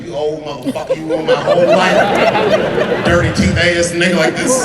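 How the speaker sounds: loud, cracking high mock yelling with laughter; cussing him out. Comic cussing out the old man in a car, loud mock rage. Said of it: "I got to cussing at him".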